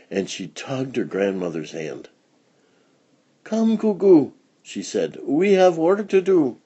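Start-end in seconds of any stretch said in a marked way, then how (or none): 3.4-6.6 put-on higher child voice; lightly voiced girl pulling grandmother home; child's urging. A narrator's reading. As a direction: urge grandmother along eagerly. Child voice tugs grandmother's hand.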